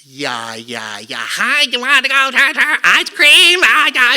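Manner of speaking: loud, high American yapping; mock American accent. Imitating how Americans sounded to newcomers, mock American accent. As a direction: caricature Americans as they sound to a Scot. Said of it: "when you guys spoke to us, it sounded like".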